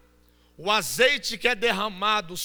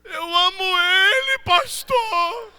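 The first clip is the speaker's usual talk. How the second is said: put-on high sobbing voice of a heartbroken girl; mock crying girl; 9 semitones higher, 7 dB louder than usual. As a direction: whine it out like a jilted young woman crying. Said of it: "ela, "Pastor, ora por mim". (imitando a moça chorando pelo namorado)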